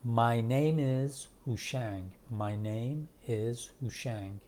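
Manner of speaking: "My name is" very slow, each word drawn out; slow full form. Shown as a demonstration. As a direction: spell out "My name is هوشنگ" word by word, unhurried.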